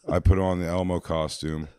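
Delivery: ordinary talk, nothing marked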